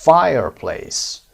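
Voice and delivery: slow teacher model of Fireplace; slow stretched diphthong. Shown as a demonstration. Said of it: "Diphthong هست".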